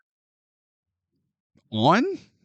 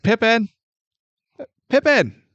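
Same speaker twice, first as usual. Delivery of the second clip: calling out; 8 semitones higher than usual. Retold or imitated. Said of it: "He's like".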